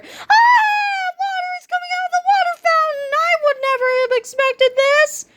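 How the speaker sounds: very high shrieking panic; hysterical screaming. A narrator's reading. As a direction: shriek it in silly terror. Cheerleaders screaming at fountain.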